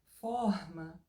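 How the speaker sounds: "forma" with a throaty French-style R. Shown as a demonstration. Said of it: "os meus R's, eles são mais estilo francês".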